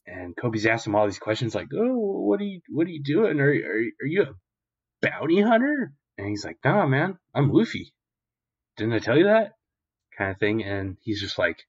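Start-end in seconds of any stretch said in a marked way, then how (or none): 1.8-5.9 high, stammered mock questions; questioning Kobe. Kobe questions stranger nervously. 6.6-9.5 lightly voiced, higher offhand reply; casual Luffy. Luffy casually answers Kobe.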